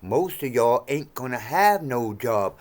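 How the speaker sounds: ordinary talk, nothing marked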